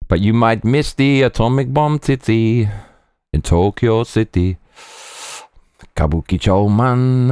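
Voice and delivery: put-on slow Jamaican Rasta accent. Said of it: "your Rasta accent is making me giggle".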